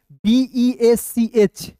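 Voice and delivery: बीईएचसीएच spelt out very slowly; slow spelling. Shown as a demonstration. Teacher spells the word slowly for students. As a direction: spell each letter slowly. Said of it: "इसे बेच नहीं पढ़ेंगे".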